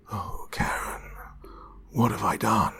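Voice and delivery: breaking, whispered regret; a soft cry of guilt; remorseful. Acted. Monster doctor ashamed.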